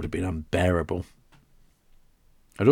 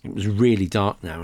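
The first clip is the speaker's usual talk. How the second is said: ordinary delivery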